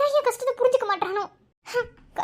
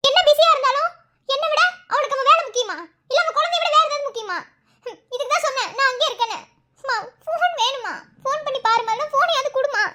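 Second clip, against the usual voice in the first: a lightly raised, higher voice of a fretting mother; upset older woman; 6 semitones higher than usual. Acted. Mother fretting to the pregnant daughter about her husband, higher, half laughing. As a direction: nag and complain, half laughing.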